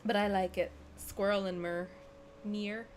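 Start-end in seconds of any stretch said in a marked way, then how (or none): 1.0-1.9 softer American "Squirrel and mirror"; understated American accent imitation. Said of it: "favorite things to hear Americans say". 2.4-2.9 quiet American "Mirror", said as "meer"; American accent. Retold or imitated. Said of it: "favorite things to hear Americans say".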